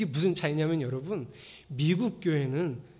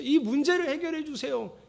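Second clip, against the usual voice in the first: worried pleading prayer; 8 semitones higher than usual. Shown as a demonstration. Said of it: "이렇게 기도하지 말고".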